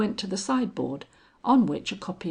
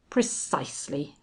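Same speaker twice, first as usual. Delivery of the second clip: smug; 3 semitones lower than usual. A narrator's reading. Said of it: "ineffably self-satisfied".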